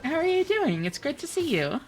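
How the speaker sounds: high cheery hello to a friend; excited greeting. Real, not acted. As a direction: lift up into a happy welcome. Greeting returning viewer.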